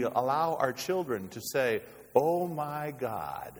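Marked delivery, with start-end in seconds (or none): 2.1-3.5 very slow, drawn-out mimicry; exaggerated imitation of careless slang; mock exclamation. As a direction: stretch the phrase out, pitch raised, to show it up. Mimics children misusing God's name.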